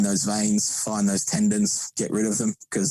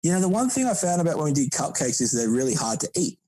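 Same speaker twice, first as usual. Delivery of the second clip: higher, lightly voiced teammate; a hint of a chatty teammate, pitched up; teammate's observation; 8 semitones higher than usual. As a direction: imitate him making his point. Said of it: "he's like".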